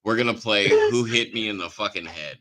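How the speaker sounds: ordinary delivery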